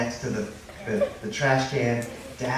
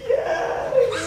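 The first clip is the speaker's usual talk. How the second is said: put-on high, wailed little-boy answer; hammed-up kid's bawl; howling, crying child; 3 dB louder than usual. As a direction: sob it out like a hurt toddler. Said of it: "he started howling and crying".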